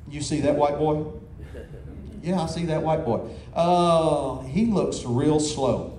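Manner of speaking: two dogs sizing up their prey, lightly growled; dog talking. Retold or imitated. Preacher voicing two dogs eyeing him, mildly low. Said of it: "it went something like this".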